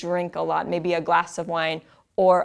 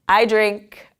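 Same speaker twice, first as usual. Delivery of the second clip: normal talking voice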